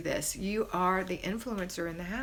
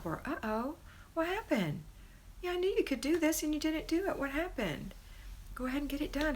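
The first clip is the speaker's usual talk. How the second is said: checking mom; 7 semitones higher than usual. Retold or imitated.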